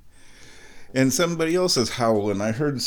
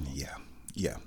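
whisper; 9 semitones lower, 15 dB quieter than usual. Real, not acted. Savouring tea, whispered aside.

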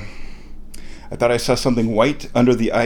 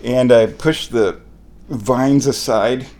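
ordinary talk

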